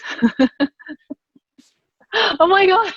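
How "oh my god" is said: high, laughing exclamation; cracking up at a joke; real laughter. Real, not acted. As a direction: squeal it through helpless laughter. Laughs at host's end-of-world joke.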